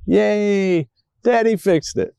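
put-on high little girl's hooray; child's high-pitched cheer; young daughter cheering. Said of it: "I heard her from her room".

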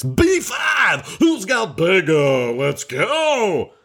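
hyped-up high shouting bingo call; bingo caller. Pretend. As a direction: call the numbers like a game show host. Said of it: "I should do it like Scott Eubanks".

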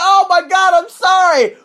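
high, loud mock pleading apology. Imitating the beaten driver begging the cops, high and loud. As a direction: squeal an apology like the beaten man. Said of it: "he was like".

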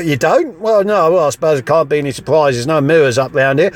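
ordinary talk

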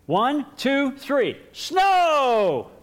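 put-on high, loud kid shout, counting up to snow; kid's shout for snow. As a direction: yell the command at the sky. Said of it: "So he's speaking to God".

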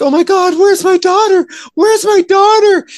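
hammed-up frantic mother, high-pitched and loud; mock panic. Podcaster mocks mother searching for her daughter, mock panic.

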